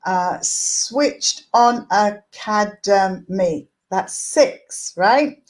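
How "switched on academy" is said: slow, "switched on academy" split into its six syllables; spaced syllables, counting them out. Shown as a demonstration. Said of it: "If I do".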